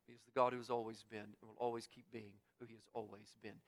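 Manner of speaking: ordinary talk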